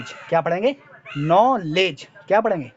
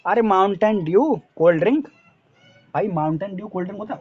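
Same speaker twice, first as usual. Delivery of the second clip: ordinary talk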